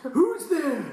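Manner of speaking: put-on high frail old man voice. Shown as a demonstration. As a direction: show how a scared old man calls.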